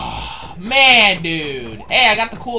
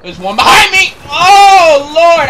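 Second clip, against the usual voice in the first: very loud alarm, pitched up; panicked shout; 10 semitones higher, 12 dB louder, slower than usual. Real, not acted. Player finding an enemy behind him, panicked shout. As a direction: yell it as if being chased.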